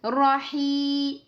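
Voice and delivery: "Rahi" with the final i held for two beats; two-beat madd. Shown as a demonstration. Teacher showing the madd tabi'i. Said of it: "Donc deux temps".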